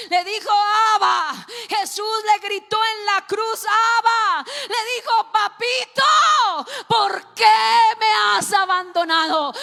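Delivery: loud, high shouting sermon; preaching at full force. Acted. Preacher shouting Jesus's cry from the cross, full force. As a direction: cry it out at the top of your voice.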